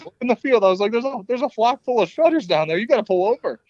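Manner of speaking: high, urgent excited pleading; frantic excitement. Retold or imitated. Hunter begging girlfriend to stop for turkeys, frantic and high. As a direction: pitch it way up, desperate to stop the car. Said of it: "And I'm like".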